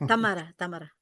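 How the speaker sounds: Tamara repeated with open a's and tapped r; Spanish pronunciation. Shown as a demonstration. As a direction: try "Tamara" the Spanish way, twice. Echoing the Spanish pronunciation just shown.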